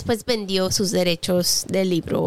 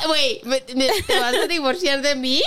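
laughing; 4 semitones higher than usual. Real, not acted. Laughing mock alarm at partner.

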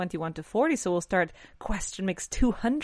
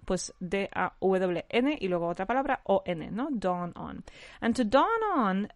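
ordinary delivery

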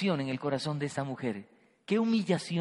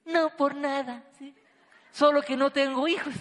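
higher, bitter sarcastic answer; sarcastic wife's reply; 7 semitones higher than usual. Pretend. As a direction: answer him with biting sarcasm, pitched up. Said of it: "de Ana qué hubiera respondido?".

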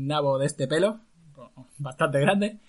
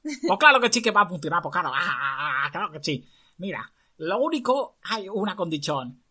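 high, loud clown doctor voice; 4 semitones higher, 6 dB louder than usual. Acted. Disguised girl boasts to court as clown doctor. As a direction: play a funny foreign doctor. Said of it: "Aunque hablaba con esta voz".

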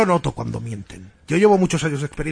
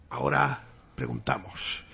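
slow, hushed summoning; séance medium; 4 semitones lower, 6 dB quieter, slower than usual. Acted. Medium asks a spirit its name, slow and solemn. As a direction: question the spirit slowly and solemnly.

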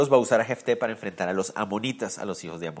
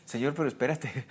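hushed pleading whisper to the Lord; whispered "Señor, pero espérate"; 2 semitones higher, 4 dB quieter than usual. Retold or imitated. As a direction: breathe it out as a protest. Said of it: "ellos están escuchando como que".

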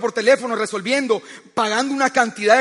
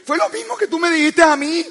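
ordinary talk

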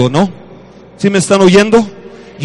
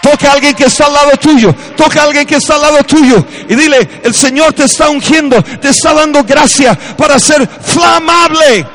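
shouted, much higher and louder, stretched words; preaching at full force; 11 semitones higher, 7 dB louder than usual. Acted. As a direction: preach like the roof is on fire. Preacher building to climax. (predicando a gritos con fervor)

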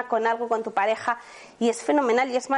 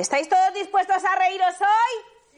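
calling out to audience; 6 semitones higher than usual. Real, not acted. Rallying the workshop audience.

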